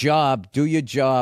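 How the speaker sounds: slow Boston job, open jaw vowel; Boston accent. Shown as a demonstration. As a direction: job said like saw. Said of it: "say the line again".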